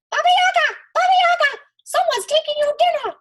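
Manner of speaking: very high, loud goose squawk; squawking geese. A narrator's reading. The geese squawk to wake Baba Yaga. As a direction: screech it like alarmed geese. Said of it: "woke and squawked".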